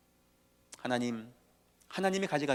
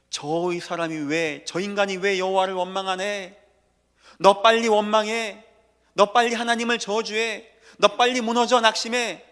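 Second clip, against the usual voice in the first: satan's taunting voice; 7 semitones higher, 7 dB louder than usual. Retold or imitated. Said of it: "사단은요, 이 말 듣는 걸 가장 두려워요".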